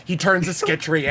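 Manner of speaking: usual speaking voice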